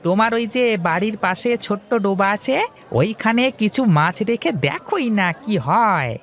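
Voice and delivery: put-on high, eager young man's voice; young fish seller Hari. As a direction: talk up to your elder brightly. Hari persuades Mahesh. (উঁচু গলায় উৎসুক তরুণ হরি)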